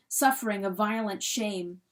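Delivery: ordinary delivery, nothing marked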